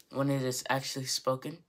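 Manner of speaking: ordinary talk, nothing marked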